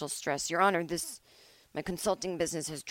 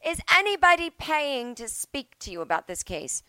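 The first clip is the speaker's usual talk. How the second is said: higher put-on judge query; mock judge; 8 semitones higher, 4 dB louder than usual. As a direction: quiz him sharply. Judge voiced asking.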